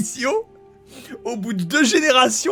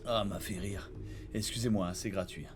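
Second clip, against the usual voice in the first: much softer, lower grumble; muttering under breath; 13 semitones lower, 16 dB quieter than usual. Real, not acted. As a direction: mutter it to yourself.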